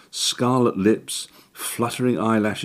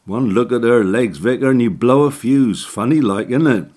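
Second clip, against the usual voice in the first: leering, chuckling yokel, louder and higher; nudge-nudge joke to the vicar, louder; cheeky sexton Jack; 4 semitones higher, 6 dB louder than usual. Acted. Jack leering over the doll.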